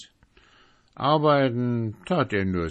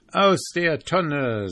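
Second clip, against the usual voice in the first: higher, slow, drawn-out showman voice; jolly showman; 9 semitones higher, 5 dB louder, slower than usual. Acted. Carnival presenting his barrel, jolly showman. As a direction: announce it like a circus director.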